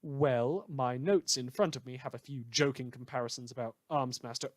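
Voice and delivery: ordinary delivery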